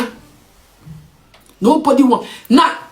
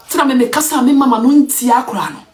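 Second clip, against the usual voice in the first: ordinary talk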